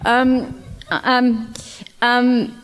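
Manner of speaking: ordinary talk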